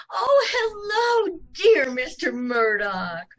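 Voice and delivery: high, gushing cry of hello. Acted. Landlady greeting Mister Murdoch, gushing high cry. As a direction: gush over the handsome salesman.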